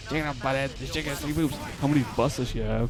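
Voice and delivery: lightly voiced rough, nasal cartoon teen; a hint of a rough, nasal Butthead; rough nasally Butthead voice. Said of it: "he had a very, like, rough, nasally voice".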